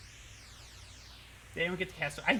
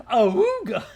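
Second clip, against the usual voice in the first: loud, high cartoon ogling horn; exaggerated comic holler; cartoon awooga; 5 semitones higher, 11 dB louder, slower than usual. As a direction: blast it out like a cartoon wolf. Cartoon wolf call at the monsters.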